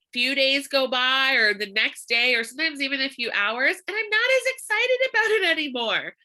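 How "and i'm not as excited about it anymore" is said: high, put-on letdown voice; sing-song mock disappointment; mock deflated. Real, not acted. Telling the audience, a high mock-deflated whine.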